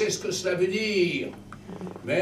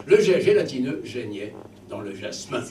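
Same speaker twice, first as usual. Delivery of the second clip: mock solemn recitation; 3 semitones lower, 4 dB louder than usual. Shown as a demonstration. Reciting the "most beautiful line" to the children, mock solemn. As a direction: declaim "Le jet gélatineux geignait dans le jasmin" grandly. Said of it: "Voici, mes enfants".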